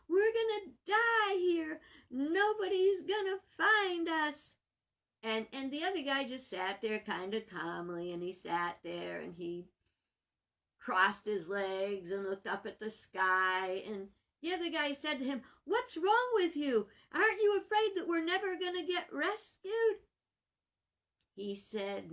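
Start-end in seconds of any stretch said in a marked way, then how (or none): 0.1-4.5 high, slow, crying wail; despairing wail. Acted. Stranded survivor wails to his companion, high and tearful. Stranded man panics on island. 15.6-20.0 high, loud, alarmed demand; frightened outburst. Acted. Panicked castaway demands why his friend is calm, high and alarmed.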